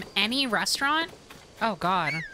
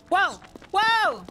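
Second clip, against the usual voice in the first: high, falling startled cries; alarmed high yelps; 9 semitones higher, 4 dB louder than usual. Real, not acted. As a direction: exclaim in alarm, higher each time. Startled in game.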